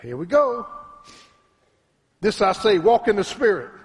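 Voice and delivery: high, loud, shouted preaching with long held peaks; full-force preaching. Acted. Preacher praising the Lord before his congregation, shouting high. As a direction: lift it to a shouting climax. Preacher climaxing and reading scripture.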